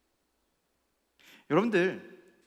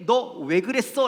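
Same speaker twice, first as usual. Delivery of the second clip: raised, loud, slowed demand; acted stern questioning; 8 semitones higher, 11 dB louder, slower than usual. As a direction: confront them as a stern God would. Voices God questioning the sinner.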